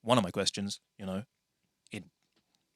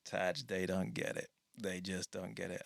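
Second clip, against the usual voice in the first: resigned friend, retold; 4 semitones lower, 11 dB quieter than usual. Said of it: "he was just shaking his head".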